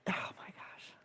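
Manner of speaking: real whisper, barely any voice; stunned whisper. Real, not acted. Host overwhelmed by the chat, stunned whisper.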